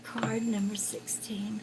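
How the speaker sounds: near-whisper, very soft; murmuring to self. Real, not acted. Psychic hunting for card sixteen, murmured to herself. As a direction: murmur it under your breath while searching. Muttering while looking for the card.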